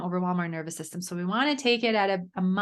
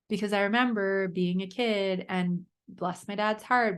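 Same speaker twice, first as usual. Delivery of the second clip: ordinary talk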